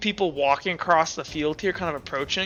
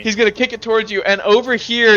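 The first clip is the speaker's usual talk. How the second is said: ordinary talk, nothing marked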